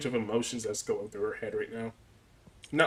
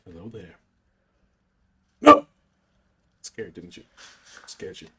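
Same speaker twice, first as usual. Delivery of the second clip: lightly voiced spooky ghost; 7 semitones lower, 7 dB louder than usual. Answers as the ghost.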